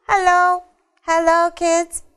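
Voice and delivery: high, loud visiting character voice; Василий. Pretend. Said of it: "он вам сказал".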